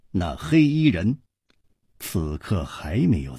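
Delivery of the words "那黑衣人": usual speaking voice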